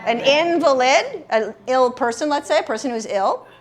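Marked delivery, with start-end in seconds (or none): 0.2-1.2 INVALID said as IN-vuh-lid; first-syllable stress. Shown as a demonstration. Teacher confirms the noun for students, invalid stressed first. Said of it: "if I put the emphasis here".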